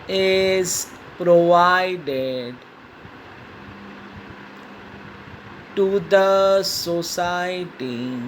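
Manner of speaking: very slow word-by-word dictation; dictating. Shown as a demonstration. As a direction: pause after each word for writing. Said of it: "dictation continues". (शब्द-शब्द रुककर लिखवाना)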